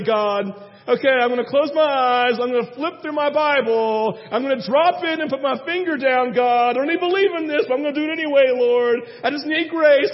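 high, loud pleading to God; a groom praying aloud over his Bible, high and loud; desperate loud prayer. Retold or imitated. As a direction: beg God out loud, worked up.

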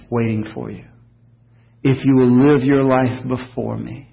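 Jesus speaking softly. Retold or imitated. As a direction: speak as Jesus, gently reassuring. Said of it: "And Jesus says".

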